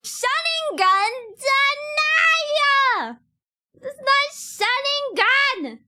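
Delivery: high, loud, slow drawn-out shriek; stretched squeal of denial; shrieking protest. Real, not acted. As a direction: protest at the top of your voice. Reading and rejecting a chat joke.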